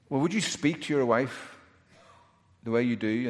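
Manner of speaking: ordinary delivery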